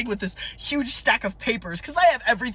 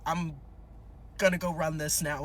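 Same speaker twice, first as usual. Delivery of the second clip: low, softer patrol officer voice; cop impression; 5 semitones lower, 7 dB quieter than usual. Officer leaves to check license, cop impression. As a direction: play the cop, low and subdued. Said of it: "my cop impression".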